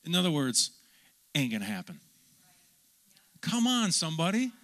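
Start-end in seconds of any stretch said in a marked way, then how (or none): 3.4-4.5 high, loud call to the crowd; rousing call. Real, not acted. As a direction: fire up the congregation. Preacher rousing the congregation.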